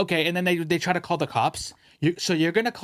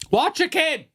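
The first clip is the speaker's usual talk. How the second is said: put-on raised, higher call of watch your kid; called-out mock scolding; 12 semitones higher, 5 dB louder, slower than usual. Scolding a parent across the grocery store, mock shout.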